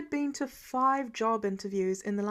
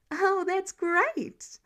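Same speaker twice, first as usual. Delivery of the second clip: bright, higher, slow delighted praise; beaming; 8 semitones higher, 4 dB louder, much slower than usual. Acted. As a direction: gush with delight. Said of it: "Karen beamed at him".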